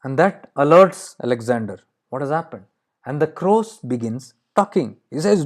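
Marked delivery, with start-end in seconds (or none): none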